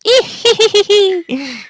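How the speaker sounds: high, squeaky little giggle; childlike villain giggle. Retold or imitated. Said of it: "this stupid little childlike giggle".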